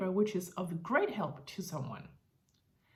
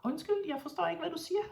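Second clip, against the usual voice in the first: higher, feigned puzzlement; mock confusion; 8 semitones higher, much faster than usual. Teacher pretending not to understand a rude child, mock puzzled. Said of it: "I would say".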